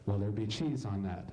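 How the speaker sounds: low, flat drive-thru drone; bored drive-thru worker. Acted. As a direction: drone it like a tired order-taker.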